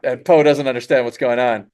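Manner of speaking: ordinary talk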